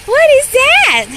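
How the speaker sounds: "What is that?" high, slow and wondering; excited surprise. Acted. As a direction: gasp of wonder for the little one. Mock surprise to toddler.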